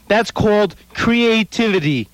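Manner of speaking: "creativity" क्री-ए-टि-वि-टी slow and exaggerated; over enunciating. Shown as a demonstration. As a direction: spell out "creativity" syllable by syllable. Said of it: "Read my lips".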